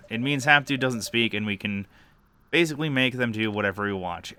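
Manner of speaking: usual speaking voice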